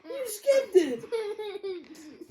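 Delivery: high laughing shout; laughing mock outrage. Real, not acted. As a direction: shout it in playful protest. Mock outrage at skipped pages.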